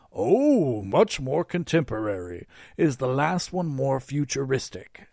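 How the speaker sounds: lightly theatrical Santa appraisal; Santa act. A narrator's reading. Santa weighs the second rocket, theatrically interested.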